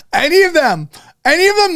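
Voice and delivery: high, delighted repeat; laughing excited. Real, not acted. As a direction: squeal it through laughter.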